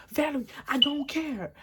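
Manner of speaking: imitated angry retort. Boyfriend dismissing her complaint, imitated heated retort. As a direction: brush her off in his heated voice. Boyfriend snaps back in quarrel.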